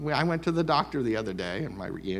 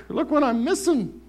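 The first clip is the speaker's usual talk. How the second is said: high, wistful whine; mock longing; 11 semitones higher than usual. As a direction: pine for what you left. Pining for old life.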